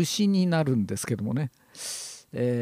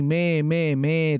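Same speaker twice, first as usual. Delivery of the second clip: sheep bleat; 4 dB louder than usual. Shown as a demonstration. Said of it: "絵に書いてあります".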